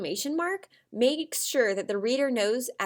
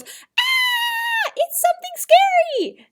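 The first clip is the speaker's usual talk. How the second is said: much higher, louder frightened scream; terrified shout; 9 dB louder, much slower than usual. Shown as a demonstration. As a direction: shriek it in fright. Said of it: "they shout and they're terrified".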